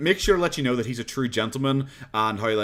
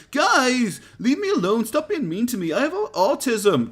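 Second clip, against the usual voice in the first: much higher, whiny mock plea, slowing down; 10 semitones higher, 3 dB louder than usual. Streamer imitating Cobra begging bar crowd, mock whiny. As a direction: mimic a pathetic guy begging to be left alone.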